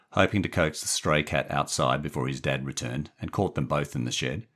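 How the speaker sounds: usual speaking voice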